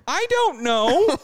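high, loud and slow; the guest at wit's end with the lost woman; exasperated. Retold or imitated. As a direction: throw up hands in exasperation.

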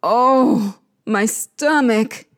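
slow groan of pain. Acted. Queen of the underworld in pain, groaning slowly. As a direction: groan it out, drawing the words. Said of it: "Ereshkigal groans".

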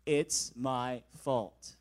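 very slow, low, earnest "It's my fault"; slow solemn apology. Retold or imitated. Groomsmen showing another version, slow and earnest. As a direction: draw out each word like a confession.

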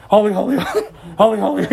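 gasping, laughing mock-weary holy holy; a hint of tired angels panting through the chant; out-of-breath angels. Preacher jokes angels are out of breath, mock-panting. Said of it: "running out of breath".

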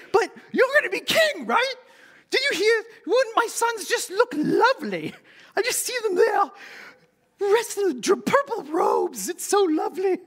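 very high put-on falsetto of a pushy mum; Monty Python mother. Pushy mother wheedling Jesus for a favor, hammed-up high Monty Python falsetto. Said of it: "a terrible Monty Python impersonation".

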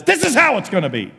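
loud, high acted decree; booming paraphrase of a divine order; commanding God. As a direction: cut off all argument as God. God overrules Moses' objections, acted.

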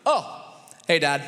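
a caught-red-handed boy playing it cool; caught kid. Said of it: "he goes".

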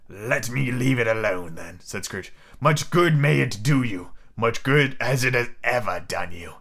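low, slow grumble; Scrooge, dismissive miser. Acted. Scrooge refusing to keep Christmas, low dismissive drawl. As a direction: brush him off in a slow low voice.

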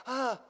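short, high, dismayed ah; crestfallen gasp. Acted. Man's face fell at the demand, crestfallen gasp. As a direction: gasp as the hope drops away. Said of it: "His face fell".

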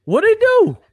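high, loud disbelief; incredulous. Real, not acted. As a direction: demand it in disbelief. Arguing about the game.

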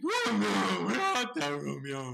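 high, loud stage heroine burlesque; big comic lovesick wail; mock-dainty Juliet. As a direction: call out like a hammed-up damsel. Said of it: "this very dainty".

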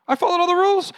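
high, loud mock whine; indignant complaint. Believer blaming God, high indignant whine. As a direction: protest to God as if cheated. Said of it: "Like, "God".

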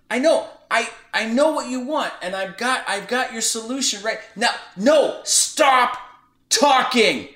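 high, loud domineering overtalk; loud and belligerent. Retold or imitated. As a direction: steamroll right over the other person. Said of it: "And he just very loudly".

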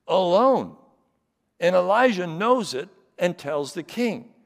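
loud, high, driving sermon voice; full-force preaching. Acted. Pastor telling the Elijah story to the congregation, loud and high.

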